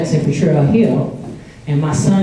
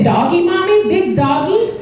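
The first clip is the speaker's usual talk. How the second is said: put-on high, wondering little-child voice; a toddler asking his mother about cows, high and curious; 12 semitones higher than usual. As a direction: ask like a three-year-old seeing cows. Imitating three-year-old son.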